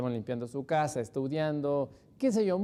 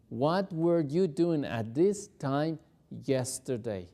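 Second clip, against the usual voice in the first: steady, slow, clear; slow full model sentence; slow whole question; slower than usual. Shown as a demonstration. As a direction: say it whole, slowly. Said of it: "El verbo were lo tenemos en pasado".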